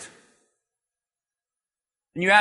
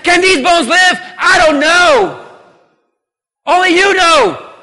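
fervent proclamation; 8 semitones higher, 6 dB louder than usual. Retold or imitated. Ezekiel answering God, shouting in surrender. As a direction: cry out I don't know with faith. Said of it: "No, he said".

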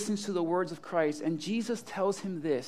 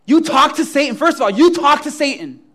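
high, loud, outraged disbelief; loud incredulity; 7 semitones higher, 14 dB louder than usual. Acted. Imagined Peter questions Jesus about Satan, loudly incredulous. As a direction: blurt it out, hammed-up and aghast.

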